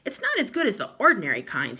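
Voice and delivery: talking through a mouthful; chewing while complaining; mouth full. Acted. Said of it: "talking with his mouth full".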